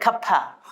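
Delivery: खप्पा with a breathy kh, tongue in cheek; playful mispronunciation. Shown as a demonstration. Teacher repeats the joke name to class, playful.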